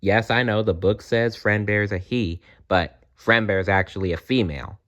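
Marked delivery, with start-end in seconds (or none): none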